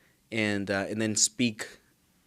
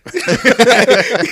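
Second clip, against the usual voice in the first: loud high burst of laughter; 13 semitones higher, 14 dB louder than usual. Real, not acted. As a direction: crack up loudly.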